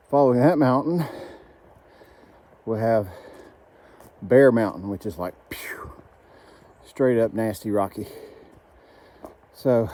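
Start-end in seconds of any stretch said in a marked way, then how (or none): 4.9-5.9 unvoiced whisper; whispered aside. Real, not acted. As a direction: drop to a breathy whisper. Whispered aside about nasty climb.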